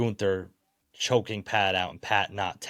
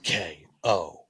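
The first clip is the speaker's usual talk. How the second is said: slow announcer emphasis; 3 dB louder, slower than usual. Acted.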